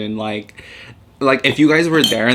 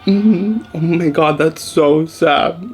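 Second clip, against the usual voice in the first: high, very slow, loud mock sorrow; faked sympathy; 5 semitones higher, 4 dB louder, much slower than usual. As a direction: feign sadness while holding in laughter. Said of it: "so embarrassed and so ashamed".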